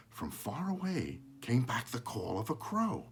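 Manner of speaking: plain reading voice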